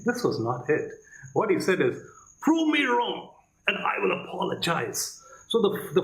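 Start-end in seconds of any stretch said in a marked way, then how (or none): 2.4-5.2 high, punchy prove me wrong, boastful; arrogant challenge. Retold or imitated. Mimicking the debater's boastful challenge to his opponent. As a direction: say it like you know better than everyone. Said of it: "the focus, the emphasis ... on me".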